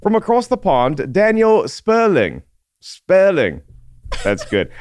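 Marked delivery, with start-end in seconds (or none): 0.0-3.6 high-pitched mock British accent; hammed-up British accent, higher than usual; put-on British accent. Shown as a demonstration. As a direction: do a playful British accent, pitched up, drawing out the name. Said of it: "Say it in an accent".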